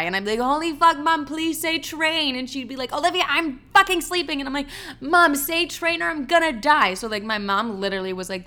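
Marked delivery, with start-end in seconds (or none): 0.4-2.3 high, drawn-out plea; panicked pleading. Retold or imitated. Daughter begging mom to say train, panicked pleading. 2.9-4.4 high-pitched irritated complaint; exasperated mom. Retold or imitated. Woken mom to her daughter, exasperated. 5.0-6.9 high, desperate demand; urgent pleading. Retold or imitated. Daughter to sleeping mom, desperate pleading.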